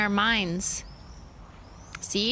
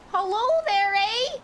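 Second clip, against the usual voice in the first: put-on Canadian accent; 10 semitones higher than usual. Handing chat a bagel, hammed-up high Canadian voice. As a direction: play a goofy Canadian offering bagels.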